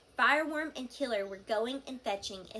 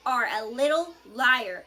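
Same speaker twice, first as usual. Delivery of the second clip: much louder, slow menacing line; hissed accusation; 2 semitones higher, 9 dB louder than usual. A narrator's reading. Fireworm creeps forward to hiss at Toothless. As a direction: let the threat sink in. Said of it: "hissed Fireworm".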